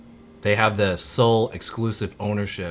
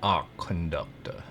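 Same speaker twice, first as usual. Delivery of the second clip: a tentative stab at English accent, quieter; attempted English accent; 3 semitones lower, 6 dB quieter than usual. Shown as a demonstration. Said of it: "more of, like, a southern accent".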